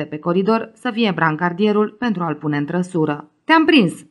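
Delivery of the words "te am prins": high, loud gotcha; spring it on him from behind, bright and loud; playful exclamation. A narrator's reading. Friend covering Emanuel's eyes from behind, high loud exclamation. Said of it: "exclamă cineva".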